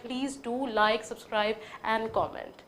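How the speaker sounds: slow plea. Real, not acted. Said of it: "please, please".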